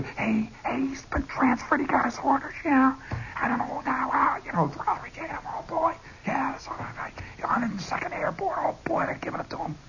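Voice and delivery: hushed, breathless gossip whisper; whispered rumor. Retold or imitated. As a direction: pass on the secret under your breath. Corporal spreading rumor secretly.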